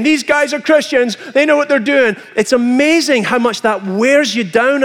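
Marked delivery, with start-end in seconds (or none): 0.0-2.2 higher, announcing like a herald ringing a bell; mocking town crier. Preacher mocking critics as a bell-ringing herald. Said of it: "a crier, ringing the bell saying".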